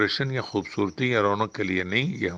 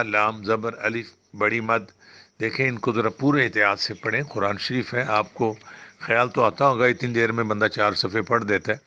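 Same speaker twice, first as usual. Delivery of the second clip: ordinary delivery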